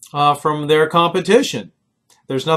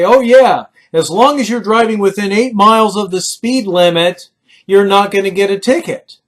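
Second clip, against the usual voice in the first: louder, higher, carefree shrug of a voice; breezy casual voice; 5 semitones higher, 6 dB louder than usual. Retold or imitated. People he grew up with excusing speeding, breezy. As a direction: toss off the excuse airily. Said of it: "people who would say".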